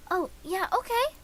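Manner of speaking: normal talking voice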